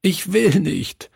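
high, pleading, sobbing no. A narrator's reading. Boy refusing to move to Hebron, sobbing. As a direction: beg through tears. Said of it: "schluchzte er".

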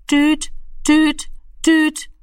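high, level dial-tone beeps; telephone tone imitation. A narrator's reading. The telephone receiver sounds for Rami, imitated dial tone. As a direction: imitate the phone's beeps on one high note. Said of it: "machte es".

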